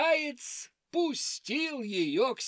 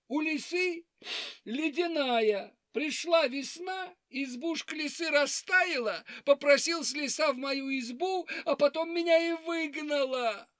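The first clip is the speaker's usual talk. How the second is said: high weepy hare voice; 6 semitones higher than usual. Acted. Hare complains again.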